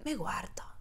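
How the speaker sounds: hushed, tense whisper. Retold or imitated. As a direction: whisper it like something creepy is happening. Tense moment at the date.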